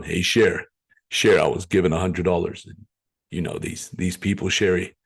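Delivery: low-pitched mock phone-call voice; put-on low voice, confiding in a friend; Stevie. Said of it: "that's my impression of Stevie's voice".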